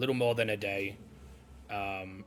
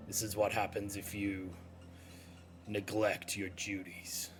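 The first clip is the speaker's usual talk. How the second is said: quiet menace; 7 dB quieter than usual. Acted. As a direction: let the warning land softly and slowly. Game master voices a menacing figure.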